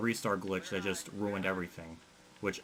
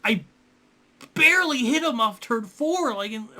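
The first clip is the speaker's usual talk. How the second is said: outraged protest; 12 semitones higher, 8 dB louder than usual. Real, not acted. Gamer protesting that the wreck was not their fault, outraged yell. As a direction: yell it in furious disbelief.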